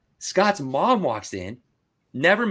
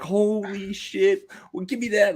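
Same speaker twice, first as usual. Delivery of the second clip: much higher, slow, astonished; drawn-out shock at the card; stunned; 11 semitones higher, slower than usual. Retold or imitated. Reliving seeing the one-of-one card, in slow, much higher astonishment. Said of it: "I'm like".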